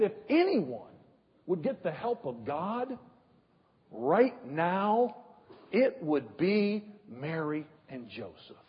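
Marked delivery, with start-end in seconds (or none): none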